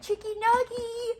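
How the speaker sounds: put-on very high sing-song baby call; baby talk. Aunt cooing to a crying teething baby, very high. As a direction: coo her name way up high.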